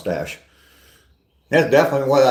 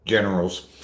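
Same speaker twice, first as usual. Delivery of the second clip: half-hearted stab at a famous politician's voice, a bit lower; Trump impression; 3 semitones lower, 5 dB quieter than usual. Streamer doing a quick imitation for his chat, lightly voiced. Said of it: "a little imitation of Trump saying".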